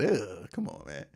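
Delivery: usual speaking voice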